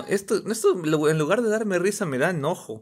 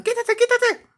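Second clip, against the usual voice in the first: high-pitched imitated warning cry; mock yell; slower than usual. Bystanders to the child in the way, high mock yell. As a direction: imitate an urgent, high shout. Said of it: "Le gritan".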